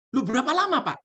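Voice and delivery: high, dismayed wife; wife's voice. Acted. Wife pressing the shaman about the time, high and dismayed. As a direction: pitch it up, dismayed.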